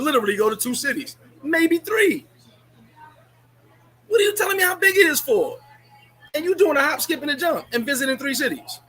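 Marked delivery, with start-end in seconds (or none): 1.4-5.6 higher and louder, incredulous; exasperated outburst at the viewers; exasperated rant. Real, not acted. 6.3-8.8 mocking rant. Real, not acted. Ranting at viewers.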